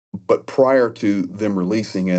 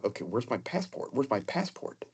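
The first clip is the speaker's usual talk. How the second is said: muttering to himself; 2 semitones higher, 13 dB quieter than usual. Retold or imitated. Panicked self-talk at the plane.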